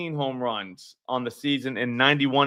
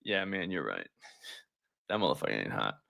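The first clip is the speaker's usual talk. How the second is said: much lower and softer; grudging admission; 9 semitones lower, 11 dB quieter than usual. Pretend. Telling Samuel how to own a bad take, low grudging concession. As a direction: concede it quietly, voice dropped right down. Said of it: "Just be like".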